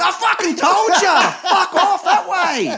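laughing shout at the caught couple; laughing yell. Retold or imitated. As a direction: yell it out the window while cracking up. Yelling out window laughing.

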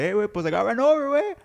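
high, exaggerated buddy voice; Javi impression. Friend showing how his buddy talks, high put-on voice. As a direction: imitate your friend announcing it in his high voice. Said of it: "And the way he talks".

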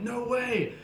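high-pitched retold astonishment; amazed disbelief. Partygoers reacting to meeting an Aussie, amazed. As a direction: imitate a thrilled party guy.

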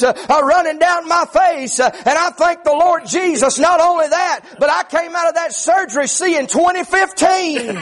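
high, driving Southern-revival preacher cadence; full-force preaching. Acted. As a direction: preach it hard in rising, rhythmic phrases.

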